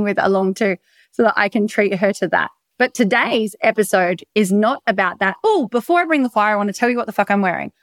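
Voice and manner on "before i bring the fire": usual speaking voice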